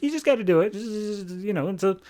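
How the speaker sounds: high-pitched, stammering mock persuasion; coaxing pressure. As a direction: act out a wheedling push to go along. Eclipso coaxing a reluctant Gordon.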